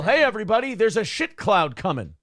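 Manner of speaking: half-hearted yell, quoting a movie; mock yell. Host quoting a Kingpin line to co-host, mock yell. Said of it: "like if I yell".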